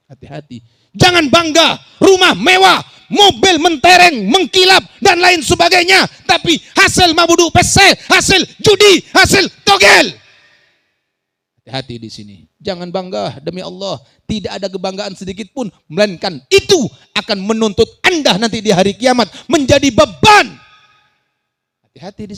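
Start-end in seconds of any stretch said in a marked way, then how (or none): 0.9-10.1 high, shouted sermon warning; full-force preaching. Acted. Preacher warns congregation against pride in gambling riches, full-force preaching. 15.9-20.5 loud sermon warning swelling to a shout; full-force preaching. Acted. Preacher warns congregation of judgment day, forceful preaching.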